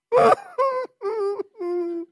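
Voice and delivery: a sudden high broken sob; the voice cracks into a sob; choked sob. Real, not acted. Pastor choked up recalling boy.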